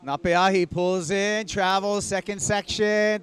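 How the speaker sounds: play-by-play. Acted. Live call of a wave.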